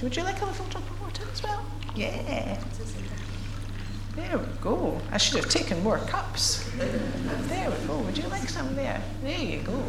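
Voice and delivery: normal talking voice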